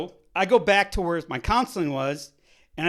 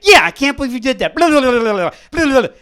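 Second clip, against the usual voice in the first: loud, fast, higher nagging rant; mock berating nurse; 6 semitones higher, 14 dB louder, faster than usual. Imitating the nurse who yelled at him, loud nagging. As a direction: rattle it off like a scolding nurse.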